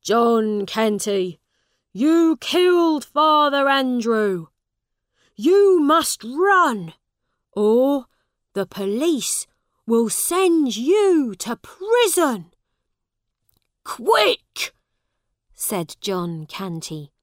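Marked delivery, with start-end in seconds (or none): 0.0-12.5 loud, urgent warning; shouting. A narrator's reading. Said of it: "A man shouted". 13.8-14.7 high, loud burst; urgent command. A narrator's reading. Father urges family to flee.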